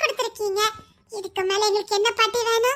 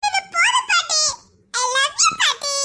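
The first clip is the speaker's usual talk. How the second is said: high, laughing sobs of relief; tearful joy; 8 semitones higher than usual. Acted. Grandchild thanking grandmother, laughing through tears.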